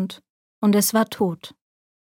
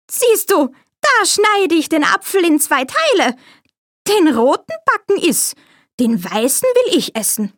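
alte Bäuerin; 7 semitones higher than usual. A narrator's reading. Bäuerin bietet Apfel an.

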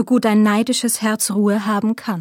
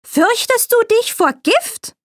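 alte Bäuerin; 13 semitones higher than usual. A narrator's reading. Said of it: "sprach die Alte".

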